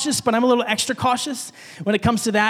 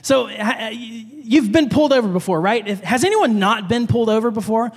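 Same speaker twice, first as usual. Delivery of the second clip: ordinary delivery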